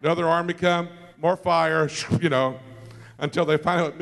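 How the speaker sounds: ordinary talk, nothing marked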